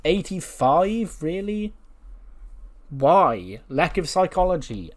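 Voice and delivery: can't believe the score; drawn-out disbelief at a rating of eighty-five; incredulous. Real, not acted. Outraged at a low rating.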